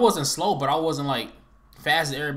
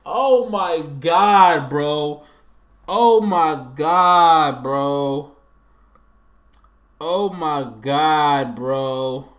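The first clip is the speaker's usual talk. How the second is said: slow, drawn-out and louder oh my God; exasperated; 6 dB louder, much slower than usual. Real, not acted. Streamer groaning at his team's blunder, slow and exasperated. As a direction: stretch it out in disbelief. Despair after a team blunder.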